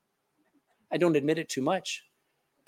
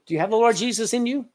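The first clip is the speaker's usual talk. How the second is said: ordinary delivery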